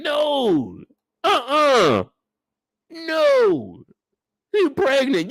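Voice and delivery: high, wailing no, no; hammed-up sobbing protest; mock crying plea. Streamer wailing at a mom for slapping her pregnant kid.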